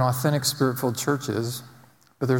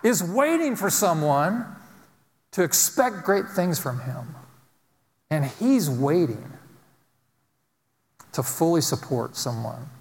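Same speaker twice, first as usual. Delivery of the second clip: higher, rousing preacher's cadence; full-force preaching; 5 semitones higher, slower than usual. Acted. Pastor rousing his congregation, full-force preaching.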